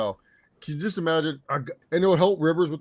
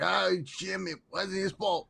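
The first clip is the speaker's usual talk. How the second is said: mimicking Tony Romo; 5 semitones higher than usual. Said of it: "he's like".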